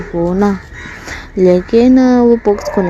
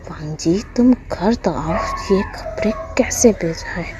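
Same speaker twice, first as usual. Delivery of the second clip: hushed, half-whispered grumbling threat; muttered anger; 7 dB quieter than usual. A narrator's reading. Embarrassed mum muttering threats at absent Wang ji, quietly angry. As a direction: fume under your breath, softer. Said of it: "गुस्सा कर रहा था".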